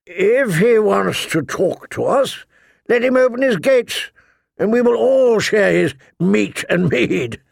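high, emphatic declaration; declaring knight. A narrator's reading. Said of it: "declared Sir Wendell Manderley".

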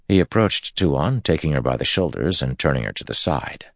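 ordinary delivery

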